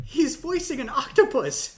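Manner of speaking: high, loud, slow excited reveal; excited news. Retold or imitated. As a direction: burst out with the big news. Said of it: "I was like".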